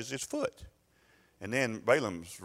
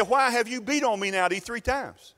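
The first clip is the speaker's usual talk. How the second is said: high, hurt complaining voice of the donkey; donkey's voice; 11 semitones higher than usual. Acted. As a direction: plead like a wronged she-donkey, pitched high. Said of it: "She said".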